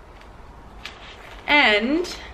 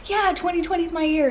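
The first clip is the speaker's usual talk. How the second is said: mock cheerful quote; 6 semitones higher than usual. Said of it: "talked to one person that's like".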